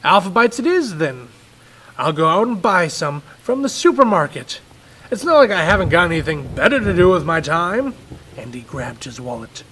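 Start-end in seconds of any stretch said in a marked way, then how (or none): 0.0-7.9 loud, sarcastic dad; father snapping at his son; angry. Acted. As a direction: bite out the words in angry sarcasm. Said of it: "Daddy was angry".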